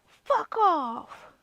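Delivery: high, slow put-on voice.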